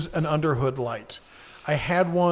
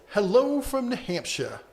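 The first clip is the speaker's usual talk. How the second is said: high-pitched mock New Hampshire accent; hammed-up New Hampshire greeting, pitched up; bad New Hampshire accent; 7 semitones higher than usual. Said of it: "my bad New Hampshire accent".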